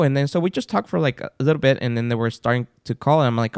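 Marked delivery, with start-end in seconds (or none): none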